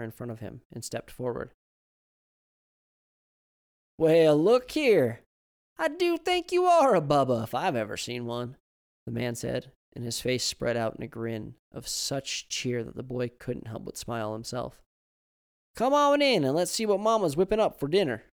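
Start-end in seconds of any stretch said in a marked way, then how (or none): 4.0-8.6 put-on high, loud grumbling old Southern man. Old man complains to his wife about the locks, loud and high. 15.8-18.2 put-on high, loud grumbling old Southern man. Old man complains to his wife about the locks, loud and high.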